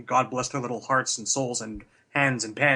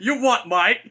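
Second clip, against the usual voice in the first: higher, louder hammed-up Cockney; mock Cockney; 7 semitones higher, 6 dB louder than usual. Co-host keeps voicing Scarlet for laughs, mock Cockney. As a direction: challenge in a put-on Cockney. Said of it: "No more voices for stealth action Scarlet".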